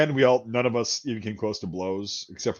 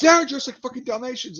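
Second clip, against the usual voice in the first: high-pitched, outraged Brit imitation; losing his shit; 11 semitones higher than usual. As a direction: mimic an indignant Brit ranting. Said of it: "he lost his shit".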